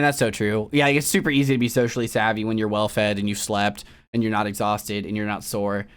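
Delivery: normal talking voice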